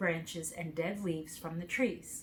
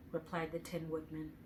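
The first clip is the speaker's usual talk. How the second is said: usual speaking voice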